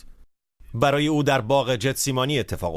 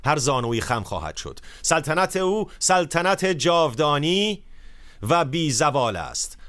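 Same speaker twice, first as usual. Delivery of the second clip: solemn scripture proclamation; 4 semitones higher, slower than usual. Acted. Proclaims Daniel's vision.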